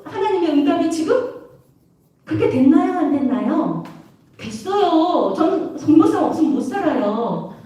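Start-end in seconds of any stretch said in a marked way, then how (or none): none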